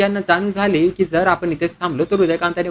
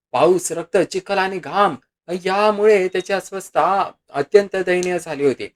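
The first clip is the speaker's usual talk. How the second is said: usual speaking voice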